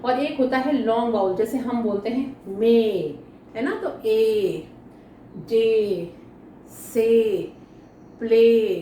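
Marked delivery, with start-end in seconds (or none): 2.5-3.1 मे with the ए drawn out long; long vowel. Shown as a demonstration. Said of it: "ए को हम थोड़ा लंबा खींचते हैं". 4.1-8.7 ए, जे, से, प्ले each with a long drawn ए; long vowel. Shown as a demonstration. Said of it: "ए को हम थोड़ा लंबा खींचते हैं".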